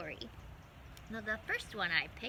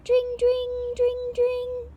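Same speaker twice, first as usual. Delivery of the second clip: much higher, louder sing-song ding of a bell; bicycle bell; 14 dB louder, much slower than usual. Acted. Mice ringing bicycle bells on the way, sung bell sound. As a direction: ring it out like a little bike bell.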